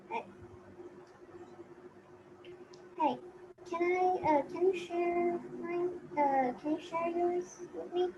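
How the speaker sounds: pleading sister. Acted. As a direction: wheedle for a share of oranges. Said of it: "it's snack time at four o'clock".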